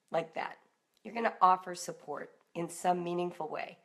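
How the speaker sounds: usual speaking voice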